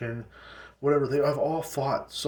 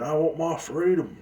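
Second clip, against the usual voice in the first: mock protester yell; 7 semitones higher, 3 dB louder, slower than usual. Mocking screaming protester.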